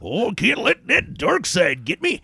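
grizzled prospector voice; crusty old miner voice. Retold or imitated. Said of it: "become an old prospector".

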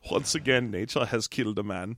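put-on low, hushed German-accented narrator; mock-solemn documentary voice; Werner Herzog. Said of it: "Werner Herzog comes on and narrates".

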